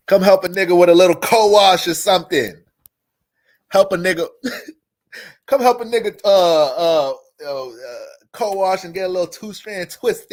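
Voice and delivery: high, loud mock plea; playful calling out. Streamer jokingly begging Virgos for chores, loud playful call. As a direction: holler for help, hamming it up.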